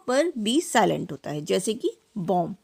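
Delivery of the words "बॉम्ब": बॉम्ब said as bom, no b sound; silent b. Shown as a demonstration. Teacher models बॉम्ब as bom for the class. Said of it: "इसे बॉम्ब नहीं पढ़ेंगे".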